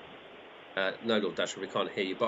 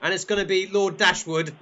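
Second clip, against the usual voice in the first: high, loud finish-line call; announcing the winner; 9 semitones higher, 7 dB louder than usual. Pretend. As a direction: proclaim the champion excitedly.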